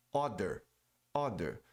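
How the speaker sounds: Other with an O and a D sound, "oder"; mispronounced other. Shown as a demonstration. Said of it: "pronuncia como D". (other errado, como brasileiro fala)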